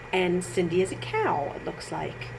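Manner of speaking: usual speaking voice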